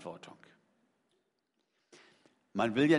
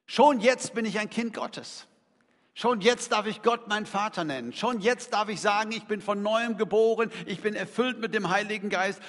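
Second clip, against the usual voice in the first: higher, louder fervent preaching; 5 semitones higher, 6 dB louder than usual. Acted. Preacher proclaiming believers' present blessings, fervent. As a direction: proclaim it with rising fervour.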